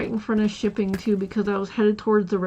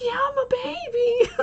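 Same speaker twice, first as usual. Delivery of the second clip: high, babyish mock whine. Telling coworker she hurt me, high mock whine. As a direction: play up being a big baby. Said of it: "And I was like".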